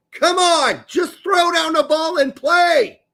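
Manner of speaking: loud, high exasperated yell; imitated mom yelling across the course. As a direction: holler impatiently, dragging out the words. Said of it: "My mom yells".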